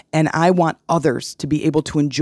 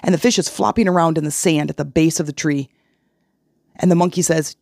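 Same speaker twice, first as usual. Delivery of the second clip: ordinary talk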